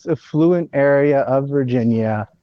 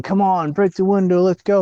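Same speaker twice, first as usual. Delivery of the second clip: mock agitator; 8 semitones higher than usual. Agitator urging crowd at Capitol.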